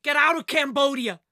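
high, loud shout of outrage; angry shout. Acted. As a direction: shout it to drive out intruders. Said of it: "she shouted".

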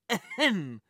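short throat-clearing ahem; pointed cough. A narrator's reading. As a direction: clear throat to get attention. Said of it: "coughed Henri".

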